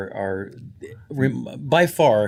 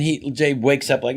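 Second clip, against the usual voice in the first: usual speaking voice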